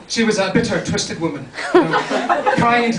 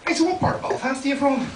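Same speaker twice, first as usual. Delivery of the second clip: put-on higher-pitched Belfast accent; Belfast girl; 7 semitones higher, 4 dB quieter than usual. Girl in bar asking where he's from, Belfast accent. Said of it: "I met this girl".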